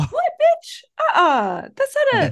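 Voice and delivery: high outraged squawk. Real, not acted. Reacting to the film, a high outraged squawk. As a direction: react like you can't believe it.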